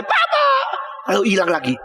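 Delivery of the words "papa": very high-pitched mock yell for dad; imitated child's cry. Preacher's child calls from the pool, imitated high yell. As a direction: imitate a small child calling out. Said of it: "Sebentar lagi dia teriak lagi".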